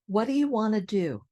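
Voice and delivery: quick "What do you" squished to "whaddaya"; squished practice model. Shown as a demonstration. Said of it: "So let's practice".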